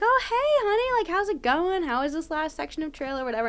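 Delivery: very high-pitched imitation of a mother on the phone; cheery mom. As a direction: chirp it like an unsuspecting mom greeting her kid. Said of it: "remember calling my mom".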